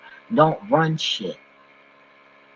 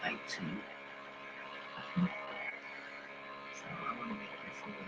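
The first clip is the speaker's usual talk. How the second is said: slow, breathy near-whisper; slow hushed murmur to viewers; 4 semitones lower, 20 dB quieter, slower than usual. Real, not acted. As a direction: let it fade to almost nothing.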